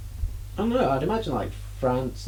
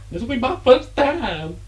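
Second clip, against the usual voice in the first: mock innocent; 12 semitones higher than usual.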